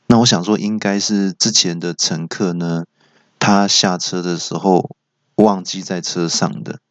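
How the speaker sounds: ordinary delivery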